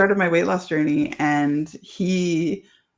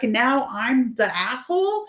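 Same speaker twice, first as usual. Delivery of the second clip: mocking husband, much higher, slow and rising; mock indignant husband; 8 semitones higher than usual. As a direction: drag out a whiny high "asshole?".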